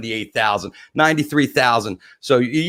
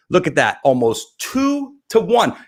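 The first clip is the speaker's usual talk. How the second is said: ordinary talk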